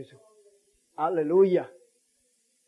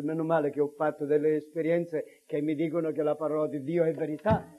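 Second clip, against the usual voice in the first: normal talking voice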